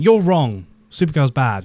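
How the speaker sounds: imitating an insistent naysayer; mock scolding. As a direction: act out telling someone off. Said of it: "This is not me going".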